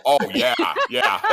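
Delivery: real laugh taking over the words; laughing agreement. Real, not acted. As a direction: agree through laughter, breaking into giggles. Laughing at hiding spot memory.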